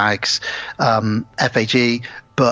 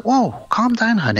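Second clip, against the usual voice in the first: higher, lightly voiced calming; wife calming her husband, lightly imitated; soothing wife; 9 semitones higher than usual. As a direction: ease him down, mock gentle. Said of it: "She's like".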